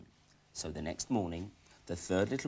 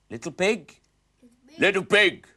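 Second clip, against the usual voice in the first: louder wolf calling; 6 semitones higher, 9 dB louder, slower than usual. Acted. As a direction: call through the door like a wolf. Wolf at the door.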